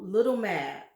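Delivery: ordinary delivery, nothing marked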